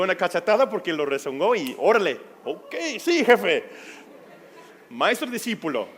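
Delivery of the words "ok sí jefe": high, laughing give-in; laughing surrender. Retold or imitated. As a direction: concede with a laugh. Teen giving in, laughing.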